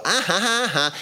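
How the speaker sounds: high mocking cackle; hammed-up taunting ha ha ha; mock laugh. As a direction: laugh like a smug host teasing the children. Mocking someone throwing bread to dogs.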